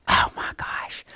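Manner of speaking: breathless whispered wonder; awed whisper. Retold or imitated. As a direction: whisper it in disbelief. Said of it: "I just went".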